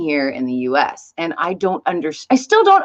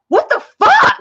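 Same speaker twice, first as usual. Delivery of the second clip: shrill high yelp, louder; shocked exclamation; 6 dB louder than usual. Retold or imitated. Parents panicking at school pickup, shocked high yelp. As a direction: blurt it in alarmed disbelief. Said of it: "seeing their panic was like".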